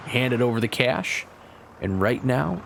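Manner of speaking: ordinary talk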